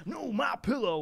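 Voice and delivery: ad pitchman. Retold or imitated. Imitating the My Pillow commercial.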